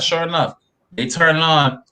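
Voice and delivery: ordinary talk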